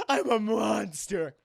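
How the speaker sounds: hammed-up monster wail; monstrous lament. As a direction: stretch it out like a tormented creature. Girl declares herself monster.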